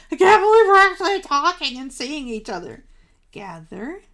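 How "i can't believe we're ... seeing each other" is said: louder, higher mock-excited gush; overjoyed group chatter, imitated; imitating excited chatter. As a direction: act out friends thrilled to finally see each other. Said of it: "because we'd be so excited".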